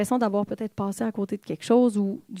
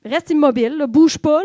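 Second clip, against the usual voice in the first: put-on louder, firm order to a child to stay still; parent giving orders; 3 semitones higher, 8 dB louder than usual. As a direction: tell a fidgety kid to hold still. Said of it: "quelque chose du genre". (ordre ferme à un enfant, plus fort)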